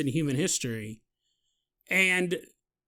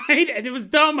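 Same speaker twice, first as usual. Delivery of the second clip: laughing; 9 semitones higher, 7 dB louder than usual. Real, not acted. Laughing at his own idea.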